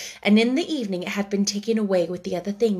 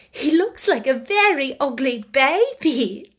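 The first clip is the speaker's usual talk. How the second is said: laughing; 5 semitones higher, 5 dB louder than usual. Acted. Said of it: "laughed Sarah".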